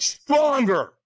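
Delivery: loud, high-pitched shout; demanding trainer. Retold or imitated. Retold trainer demands more.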